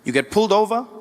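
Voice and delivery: ordinary talk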